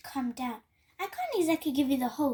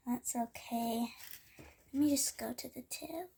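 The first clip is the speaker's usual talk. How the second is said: ordinary talk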